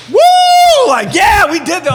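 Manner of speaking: very high, loud whooping cheer; celebrating lawyer. Retold or imitated. Lawyer dancing after the win, wild high cheer. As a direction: whoop like you just won big. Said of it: "dancing in there".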